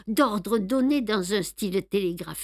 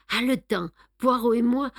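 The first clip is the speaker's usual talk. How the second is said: plain reading voice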